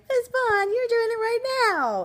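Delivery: put-on very high, loud squealing child voice; squealing kids. Teacher imitating her students, very high loud squeal. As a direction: squeal it like a delighted kid. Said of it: "they were like".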